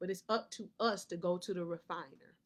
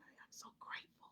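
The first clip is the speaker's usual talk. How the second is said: whisper; 20 dB quieter than usual. Pretend. Acts out the listener's inner voice.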